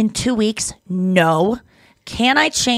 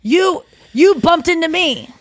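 accusing quote; 7 semitones higher, 4 dB louder, slower than usual. Retold or imitated. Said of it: "And they go".

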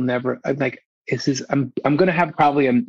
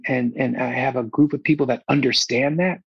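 ordinary talk, nothing marked

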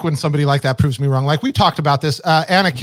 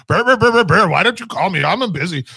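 lightly mocked friend's voice, burbling syllables; playful, higher-pitched burbling impression; Cliff; 3 semitones higher than usual. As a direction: imitate a friend as a babbling bear. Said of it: "sound in your head".

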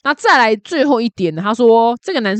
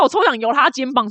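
usual speaking voice

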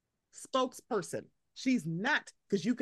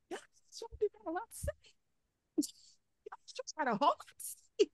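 mock Monique voice; 11 semitones higher, 4 dB quieter than usual. Podcaster mocking Monique's complaint, high put-on voice. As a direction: parody her grumble in a higher voice.

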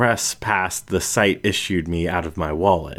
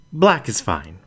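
ordinary delivery, nothing marked

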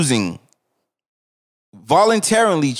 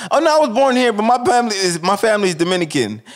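mock proud answer; 7 semitones higher, faster than usual. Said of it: "mimics the answer".

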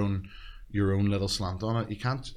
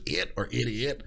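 crowd call in a Northern Irish accent; 2 semitones higher than usual. Shown as a demonstration. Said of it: "the Northern Irish accent".

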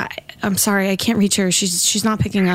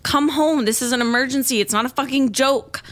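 normal talking voice

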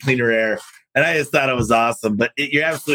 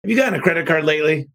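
higher, lightly voiced salesman opening a pitch; friendly sales pitch; 5 semitones higher than usual. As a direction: ask it like a smooth salesman hooking a customer. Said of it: "I like the way Dale Steele does it, is he goes".

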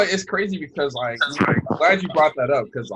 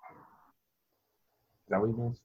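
reading under breath; 3 semitones lower, 13 dB quieter, much slower than usual. Real, not acted. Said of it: "Don't read it to yourself".

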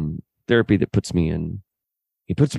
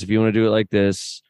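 ordinary delivery

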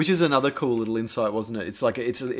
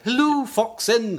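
high, slow mocking singsong; lightly hammed-up mock hello; mocking phone greeting; 9 semitones higher, much slower than usual. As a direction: put on a snooty voice to mock him. Mocking Sarah answering phone.

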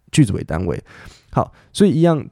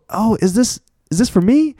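much higher-pitched questioning voice; acted surprised receiver; 11 semitones higher than usual. Shown as a demonstration. Teacher plays someone offered a gift, high acted question. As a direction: play a hesitant "for me?", high. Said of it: "我说".

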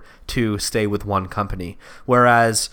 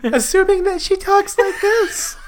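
put-on high falsetto girl voice, laughing; man doing a woman's voice; 3 dB louder than usual. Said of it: "this is my girl voice".

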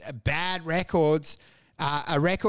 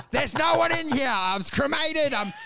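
mock dead man from coffin; 7 semitones higher than usual. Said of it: "going like".

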